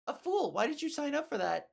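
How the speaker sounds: usual speaking voice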